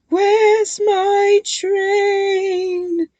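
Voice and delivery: slow, loud, high ghostly shout. A narrator's reading. Ghost chasing the twins, shouting slowly. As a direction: boom it out as a spooky ghost. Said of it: "it shouted".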